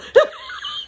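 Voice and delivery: a squealing burst of laughter, very high; high shriek of laughter. Real, not acted. Mom cracking up at the flub story, shrieking laugh.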